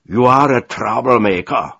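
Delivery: loud, high angry shout; furious accusation. A narrator's reading. Commodore accusing the defiant delegate, furious. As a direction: hurl it as an enraged accusation. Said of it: "zawołał czerwony z wściekłości".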